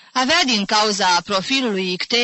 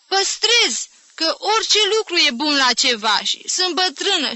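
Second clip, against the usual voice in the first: put-on much higher, earnest old woman's voice; convinced old aunt; 8 semitones higher, 4 dB louder than usual. A narrator's reading. Old aunt defending her junk to nephew, earnest. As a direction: insist with total conviction. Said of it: "cu un ton atât de convins".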